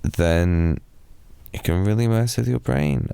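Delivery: ordinary talk, nothing marked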